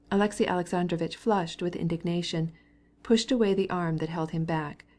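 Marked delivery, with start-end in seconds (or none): none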